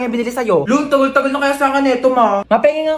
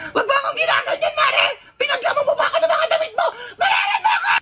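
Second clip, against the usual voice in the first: high, laughing shrieks at her friend; 4 dB quieter than usual. Acted. Friend shrieks and laughs at her pal, laughing shriek.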